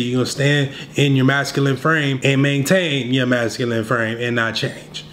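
ordinary talk

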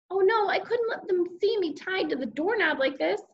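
high-pitched alarmed storytelling; mild panic. A narrator's reading. Boy about to be caught by mother, panicky. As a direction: raise the voice in a fluster.

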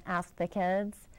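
plain reading voice

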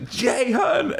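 high, ringing mock announcer call. Retelling the announcer introducing him, pitched high. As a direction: sing out the name like a ring entrance.

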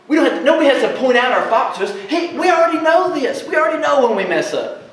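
fervent preacher; preaching at full force. Real, not acted. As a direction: drive the point home to the congregation. Preacher building to emphatic point.

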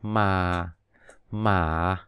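"mà" low and falling; third tone as low fall. Shown as a demonstration. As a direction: say "mà" like dấu huyền. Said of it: "gần như dấu huyền là mà".

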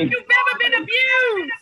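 high, loud preaching cry; preacher shouting to the congregation; rousing call. Real, not acted. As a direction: lift the room. Preacher call over music.

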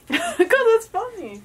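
high, slow, giggly reply; laughing as you defend yourself. Real, not acted. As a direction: answer through laughter. Laughing at her own phrase.

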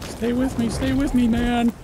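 high, loud dramatic plea; hammed-up wounded buddy plea; man down shout. Partner shot in game.

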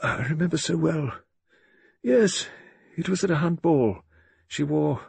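put-on high-pitched old colonel, lost in memory; musing to himself. A narrator's reading. Colonel recalls his young wife at a ball, musing aloud. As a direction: reminisce haltingly, as if to yourself. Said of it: "speaking to himself, rather than to his listener".

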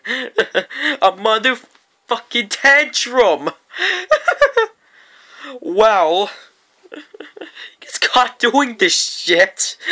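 cracking up through the words; laughing too hard to get it out. Real, not acted. Vlogger laughing at the kid's tantrum, cracking up.

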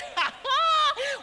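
high-pitched acted burst of laughter; mock hysterics. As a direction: imitate an audience in hysterics. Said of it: "they're in hysterics".

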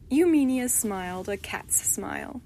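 ordinary delivery, nothing marked

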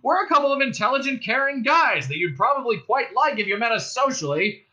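loud, high indignant cop speech; bullhorn voice. A narrator's reading. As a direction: harangue them across a distance. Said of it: "and then the bullhorn again".

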